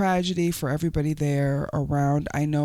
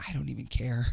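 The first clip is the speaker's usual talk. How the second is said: whispered aside; 5 semitones lower, 7 dB quieter, faster than usual. Real, not acted.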